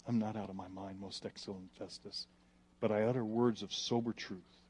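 soft, low, gentle reply; kindly. Acted. Paul answers Festus kindly, softly. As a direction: answer calmly, with kindness. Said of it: "he says kindly".

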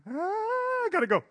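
high, hurried bird squeak; squeaky hummingbird. Acted. Hummingbird ending God's message and flying off, high squeak. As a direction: dart off with a little squeak.

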